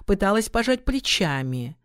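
plain reading voice

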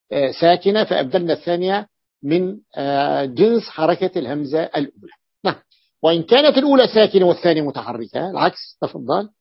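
ordinary delivery

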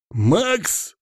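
high, startled squeak of a name; startled cry. A narrator's reading. Hamster turns and sees his friend, startled.

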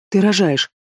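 mildly stunned husband's exclamation; shocked. A narrator's reading. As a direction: let the realisation hit you. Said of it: "шокированно выдохнул супруг".

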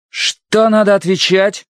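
slow, severe commander voice; stern tone. A narrator's reading. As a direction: sound terribly strict. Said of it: "Ужасно суровым тоном".

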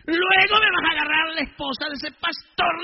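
retold furious priest giving orders; furious command. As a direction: bark the order at the gang leader. Priest retells ordering gang leader.

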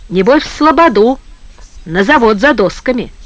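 plain reading voice

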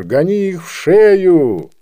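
irate official's command; shouting. A narrator's reading. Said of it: "закричал инспектор".

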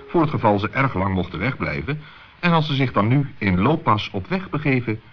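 usual speaking voice

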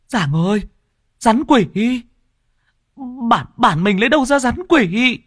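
horrified woman's voice; shocked disbelief. Acted. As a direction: gasp it out in disbelief.

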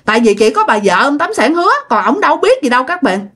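usual speaking voice

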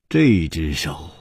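slow, low, sighing words; long sigh. Acted. Chu avoids answering about the token, sighing slow and low. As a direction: let it out slowly on a sigh. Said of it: "却长叹说".

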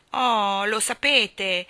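loud, drawn-out reproach; reproachful. A narrator's reading. The clown finds they know his riddle, reproachful. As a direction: stretch it out slowly in protest. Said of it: "disse in tono di rimprovero".

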